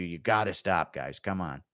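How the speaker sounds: normal talking voice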